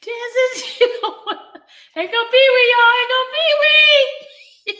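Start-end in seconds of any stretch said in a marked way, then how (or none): 1.9-2.9 high, loud laughing call; laughing through words. Real, not acted. Announces Pee-wee while laughing. 3.0-4.7 slow drawn-out laughing. Real, not acted. Repeats the call, drawn out.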